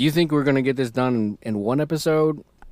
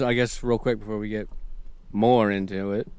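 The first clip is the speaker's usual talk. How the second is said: ordinary delivery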